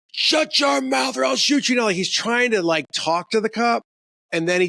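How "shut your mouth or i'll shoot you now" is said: high, shouted threat in a cop's voice; furious cop threat. Retold or imitated. Retelling the cop threatening the crawling kid. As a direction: bark it like a psychopath cop. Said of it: "And the guy's like".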